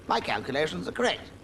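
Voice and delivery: self-important mouse proclamation; Humphrey claiming to be right; announced importantly. Acted. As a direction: declare it pompously. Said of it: "he announced importantly".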